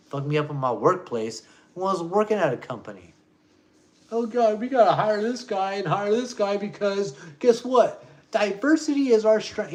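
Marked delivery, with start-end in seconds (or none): 4.1-9.7 higher, sarcastic boss voice; mock corporate boss. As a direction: parody the boss preaching diversity. Mocking company bosses hiring.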